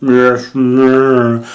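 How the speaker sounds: a hint of the creepy slowed-down kid; mock deep voice. Imitating the kid backstage still talking in that deep voice. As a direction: lightly put on a drawn-out monster-like voice. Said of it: "he's still got the voice".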